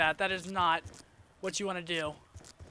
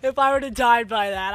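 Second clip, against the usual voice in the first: excited; 7 dB louder than usual. Real, not acted. Near-death excitement.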